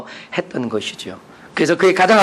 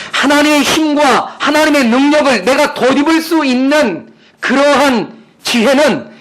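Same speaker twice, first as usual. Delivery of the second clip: raised, high-pitched preaching voice; emphatic preaching; 11 semitones higher, 6 dB louder than usual. Real, not acted. Preacher urging people to put on God's power, emphatic. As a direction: preach it out with force.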